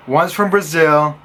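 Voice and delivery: ordinary talk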